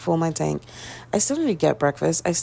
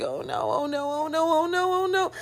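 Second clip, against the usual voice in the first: panicked building oh no; 9 semitones higher, 4 dB quieter than usual. Retold or imitated. Retelling panic at vet's news.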